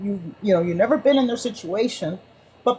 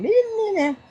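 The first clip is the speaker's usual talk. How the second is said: much higher, mock whiny me me me; mocking the pundits; 13 semitones higher than usual. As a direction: parody self-absorbed pundits. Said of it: "they're sitting there going".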